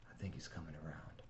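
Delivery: quiet. A narrator's reading. Quiet voice in the ambulance.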